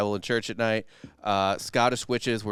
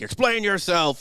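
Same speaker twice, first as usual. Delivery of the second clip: mock stern demand; 9 semitones higher, 5 dB louder than usual. Seton voiced in retelling.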